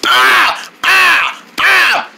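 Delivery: high, sharp repeated cries; bounced yelps. Acted. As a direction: yelp with each bounce.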